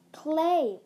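loud, high call of a name; sharp call. A narrator's reading. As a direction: call out to rouse a sleeper. Rousing a sleeping friend.

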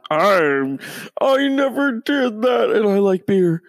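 high, loud, strained denial; hammed-up red-faced denial; mocking Kavanaugh. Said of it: "He sounds like he's trying to take a fucking dump".

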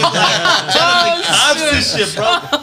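can barely get it out for laughing; cracking up retelling it to the cops; laughing hard. Real, not acted. Cracking up at friend's joke.

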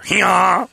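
mock hee-haw. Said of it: "I was spelling".

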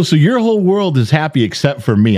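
ordinary delivery

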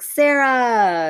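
high, loud gushing call of her name; excited. Retold or imitated. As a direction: call her name with lots of exclamation points. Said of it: "and so many exclamation points".

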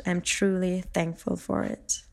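ordinary delivery

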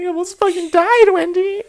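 high, loud tearful wail; hammed-up hysterical sobbing to a friend; mock crying. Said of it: "I'm, like, crying".

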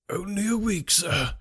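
the plea breaks as hope slips away; despairing. A narrator's reading. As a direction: butler crying out to his master in despair. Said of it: "he cried in a despairing voice".

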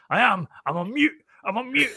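imitating a fed-up captain insisting, pitch climbing; mock furious. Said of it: "you get absolutely furious".

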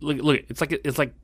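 ordinary talk

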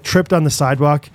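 normal talking voice

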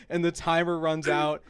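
normal talking voice

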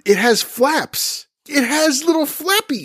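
high, drawn-out insistence; exasperated squeal. Real, not acted. Podcaster protesting to co-host about the purse, high exasperated voice.

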